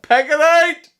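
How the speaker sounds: high, loud yelling cheer; triumphant shout. Real, not acted. Player yelling in joy after the winning move. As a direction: scream with joy at the win. Celebrating a win.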